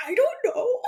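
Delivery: high, crying-tinged worry; whimpering doubt. Retold or imitated. Player unsure whom to trust, whimpering. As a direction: whimper it uncertainly. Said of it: "I was like".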